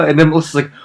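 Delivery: ordinary talk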